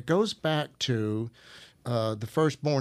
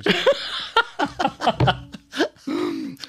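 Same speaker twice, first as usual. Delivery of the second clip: long loud high laugh; 6 dB louder than usual. Real, not acted. Laughs at the seventies.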